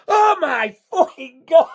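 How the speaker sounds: high, drawn-out cry of disbelief; starstruck shock. Retold or imitated. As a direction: cry out slowly, overwhelmed by amazement. Acted shock at seeing idol.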